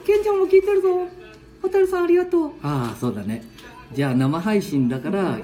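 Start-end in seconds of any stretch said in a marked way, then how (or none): 0.1-2.5 put-on high, playful little-boy voice; squeaky grandkid voice; child voice. Ken-chan voice.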